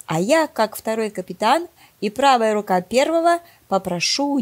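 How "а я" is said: ordinary delivery, nothing marked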